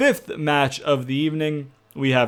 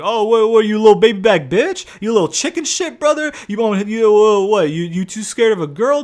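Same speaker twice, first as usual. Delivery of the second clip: much higher, louder mocking trash talk; shrill-pitched playground taunting, raised and loud; loud taunting heels; 9 semitones higher, 4 dB louder than usual. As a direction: jeer at him like a cocky heel goading a coward. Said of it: "They're like".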